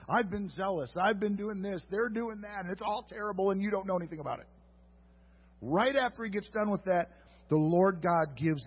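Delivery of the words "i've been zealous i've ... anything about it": higher, singsong grumbling; hammed-up self-pity; mocking whiny complaint. Preacher mimicking Elijah's complaint, higher and whiny. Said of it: "Elijah's statement".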